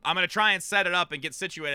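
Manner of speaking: ordinary talk, nothing marked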